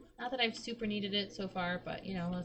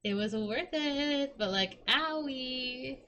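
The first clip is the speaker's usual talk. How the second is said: whiny mock complaint; 5 semitones higher, much slower than usual. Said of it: "And I was like".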